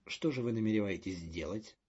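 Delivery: ordinary delivery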